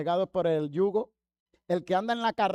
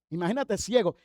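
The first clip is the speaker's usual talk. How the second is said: ordinary delivery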